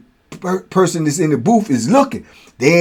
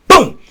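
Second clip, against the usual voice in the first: mock boom; 12 semitones higher, 5 dB louder than usual. Sound of a head hitting concrete.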